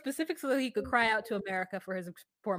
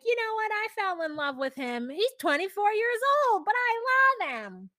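high, lilting mimicry; imitated lovestruck mother; 8 semitones higher than usual. Quoting a mother about her young boyfriend, imitated lovestruck lilt. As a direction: stretch the last words high and doting. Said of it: "I love the way she says that".

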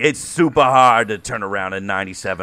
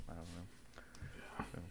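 whisper; 6 semitones lower, 31 dB quieter, much slower than usual. Real, not acted. Trailing off aside.

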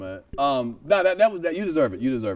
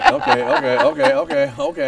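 higher and louder, laughing through repeated okays; 8 semitones higher, 6 dB louder than usual. Real, not acted. Host reacting to the slap joke, laughing loudly. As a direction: crack up while agreeing.

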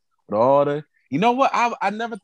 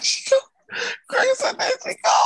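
laughing high retelling. Real, not acted. Retelling Greg's line laughing.

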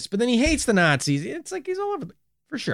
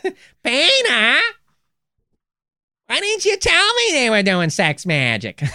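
much higher nagging call; Lois impression; 8 semitones higher, slower than usual. Host scolding Peter as Lois, higher and slow. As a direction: nag him like Lois.

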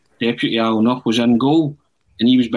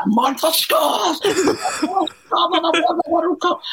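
much higher, slow, screaming goal call; ecstatic commentator shout; slower than usual. Retold or imitated. Fan in empty Maracana screams his own winning goal, ecstatic commentator shout. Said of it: "he started commentating on himself".

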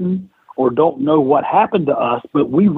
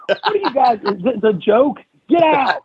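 higher-pitched indignant outburst, rising to a shout; mock outraged boss; 9 semitones higher than usual. Boss kicking two wrestlers out of his office, mock outrage. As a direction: imitate a boss throwing someone out. Acted boss kicks them out.

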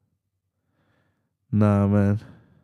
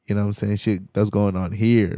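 ordinary delivery

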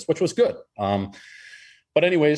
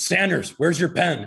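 higher, slower, pointed coach demand; coach repeating; 6 semitones higher, slower than usual. Retold or imitated. Coach repeating to the team leader, impatient. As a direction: press him, slower this time. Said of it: "He goes".

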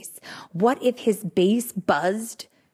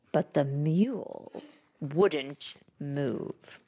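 ordinary delivery, nothing marked

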